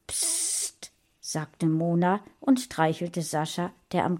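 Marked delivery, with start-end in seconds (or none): 0.1-0.9 soft, hissed shush; hushing. A narrator's reading. Friend quieting a trembling little boy, hushing. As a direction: hush him softly.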